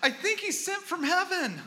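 high, loud mock gushing; drunk kid gushing. Pastor mimicking the drunk kid praising him on the phone, high. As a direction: quote the lost kid with amusement. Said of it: "he literally said this".

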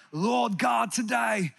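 badly imitated British accent in prayer; mock British accent. Preacher imitates how Brits sound closer to God, mock British accent. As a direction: pray like a Brit, accent laid on thick. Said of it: "like, when they pray".

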